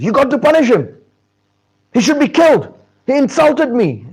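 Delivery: high, loud furious demand; hammed-up offended sage; acted anger. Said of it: "Vishwamitra gets angry".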